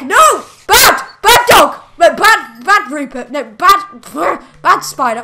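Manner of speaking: loud, sharp telling-off of a dog; scolding yell. Real, not acted. Owner scolding pet dog attacking a spider, loud reprimand. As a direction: shout the dog down like a cross owner.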